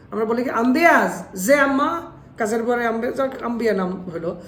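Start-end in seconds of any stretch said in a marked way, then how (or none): 0.5-2.1 higher, louder mimicry with "জে" in place of J; imitating a mispronounced "জে"; local mispronounced J. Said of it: "ওরা বলে জে".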